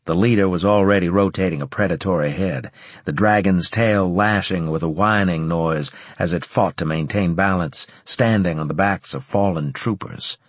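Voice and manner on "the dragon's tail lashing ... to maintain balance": ordinary delivery, nothing marked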